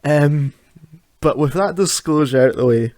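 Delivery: usual speaking voice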